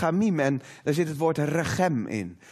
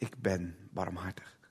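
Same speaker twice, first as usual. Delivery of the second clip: slow, much lower and softer whisper; reverent whisper; 12 semitones lower, 13 dB quieter than usual. Acted. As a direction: hush the room as if God speaks close by. God's words quoted, hushed climax.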